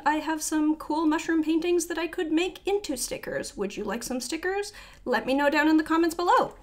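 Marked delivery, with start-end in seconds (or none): 5.1-6.6 rapid, raised and louder; quick, loud sign-off patter, pitched up; talking fast. Real, not acted. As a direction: rush through the call for comments. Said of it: "Why am I talking so fast?".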